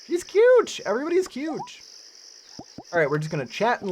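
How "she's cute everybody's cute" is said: high-pitched delighted squeal; way up high, gushing; excited gushing. Real, not acted. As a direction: squeal over how cute they are. Gushing over game characters.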